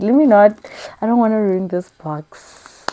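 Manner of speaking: ordinary delivery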